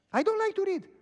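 very high, loud mimicked complaint; loud refusal. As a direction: protest shrilly as the reluctant lady. Woman refusing the book, retold.

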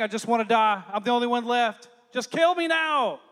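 loud, despairing plea to die; despairing Elijah, loud. Retold or imitated. Elijah crying out to God, despairing and loud. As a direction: cry out you want to die.

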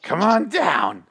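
high, slow, strained call; tense-necked pitchman. Shown as a demonstration. Host shows how he did the pitch, strained neck. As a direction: tighten your neck and drag it out, high. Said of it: "with, like, my neck, neck tense".

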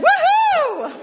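very high, loud whoop with laughter; celebrating the election win on the street; joyful whoop. Retold or imitated. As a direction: let out a giddy whoop. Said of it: "And I think I even said".

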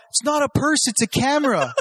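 high, laughing mock yell; yelled protest. Kid stuck on a fence yelling back at chasers, high laughing mock yell. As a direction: shout the correction while fleeing. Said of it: "I yell out".

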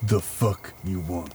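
low, quiet, hostile grunt; gruff sailor. Acted. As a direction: grumble it again, unmoved. GM repeats NPC line.

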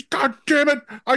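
high, loud exasperated protest; exasperated Will. Retold or imitated. Friend reacting to a face caress, high exasperated outburst. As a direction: squeal out a baffled complaint. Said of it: "And he's".

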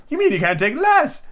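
high, theatrical correction; Hatter character voice. Acted. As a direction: say it high and pointed. Hatter voice, retake.

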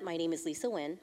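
normal talking voice

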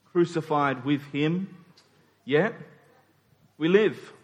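normal talking voice

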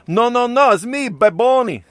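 high, loud, hammed-up Puerto Rican accent; terrible Puerto Rican accent. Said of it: "I'm doing a terrible Puerto Rican accent".

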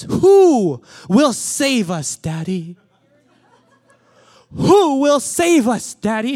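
put-on high, loud, slow child's cry for Daddy; a toddler calling her father to save her, high and loud; little daughter's plea. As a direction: play the small girl wailing for rescue. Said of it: "And she says".

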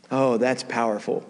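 ordinary talk

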